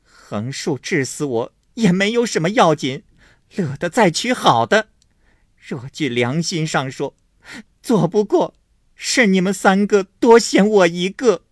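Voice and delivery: high, loud, bitter sarcasm; cold sneer. A narrator's reading. Wife sneering at the family, high and bitter. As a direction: scoff bitterly, playing the victim. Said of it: "金贵冷笑道".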